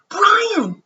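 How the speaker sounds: high, loud call of a name; shout. Acted. Justin calling Brian over to the lunch table, high and loud. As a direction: call him across the lunchroom. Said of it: "he hears someone shout".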